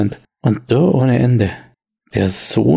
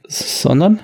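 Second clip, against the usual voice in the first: stammelnd, etwas höher; er windet sich stotternd heraus; verängstigter Wirt; 6 semitones higher than usual. Acted. Wirt wird bedroht. (stotternder Wirt, etwas höher)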